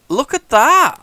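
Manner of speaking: high, loud delighted call; excited. Real, not acted. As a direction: show it off, thrilled. Showing off game move.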